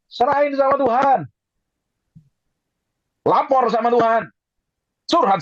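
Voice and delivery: high-pitched preacher's call; real, rousing pulpit cry; emphatic exhortation. Real, not acted. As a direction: urge the congregation with a sharply lifted voice.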